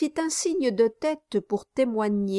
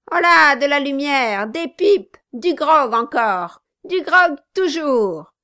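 louder, higher jovial toasting; Quilp turned boisterous host; 5 semitones higher, 6 dB louder than usual. Acted. Quilp calling for lights, pipes and grog, loud. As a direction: call for grog like a rowdy host.

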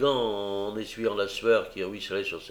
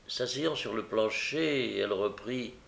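ordinary delivery, nothing marked

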